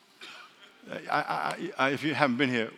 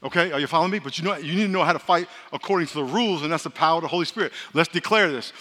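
normal talking voice

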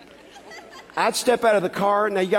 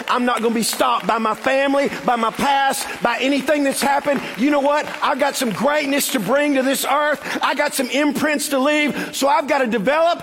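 rousing defiant declaration; 7 semitones higher than usual. Acted. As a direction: rise up and declare it, climbing to a full shout. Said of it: "rise up and say".

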